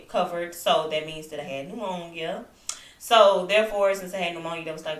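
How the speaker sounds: normal talking voice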